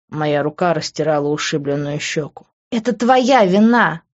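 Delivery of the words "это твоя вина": high, slow, raised-voice accusation; drawn-out angry woman's shout; accusing scream. A narrator's reading. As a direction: cry out the blame at him. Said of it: "закричала она".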